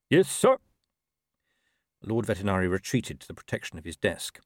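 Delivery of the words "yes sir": high, loud parade-ground answer; barked military reply. Acted. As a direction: bark it out, stiffly at attention. Still loud after told be quieter.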